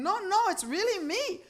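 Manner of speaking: high, loud pleading protest; yelling. Acted. Husband insisting to his disbelieving wife, yelling. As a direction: yell it, desperate to be believed. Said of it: "And he's yelling".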